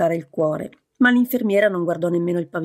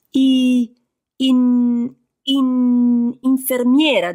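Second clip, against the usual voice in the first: higher, louder stammer that keeps catching; furious stammer; 5 semitones higher, 4 dB louder, much slower than usual. Acted. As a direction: fight to get the word out, angry at yourself. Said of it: "disse infuriata con se stessa".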